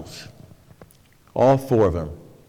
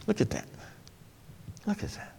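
hushed aside; 8 semitones higher, 18 dB quieter, faster than usual. Acted. Hushed repetition to congregation.

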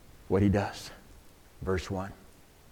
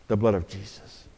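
much softer, lower reverent repeat; softer echo; 10 semitones lower, 9 dB quieter than usual. Acted. Pastor softly repeating the answer for the congregation. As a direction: let the answer sink in quietly.

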